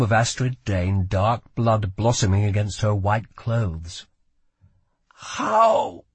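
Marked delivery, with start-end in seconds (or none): 5.1-6.0 high, tense growl; high-pitched growled demand. A narrator's reading. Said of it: "she growled".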